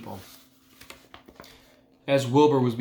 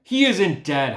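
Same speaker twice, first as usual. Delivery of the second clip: higher, louder holler; 6 semitones higher, 6 dB louder, slower than usual. A narrator's reading. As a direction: yell it across the ring. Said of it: "hollered Zuckerman".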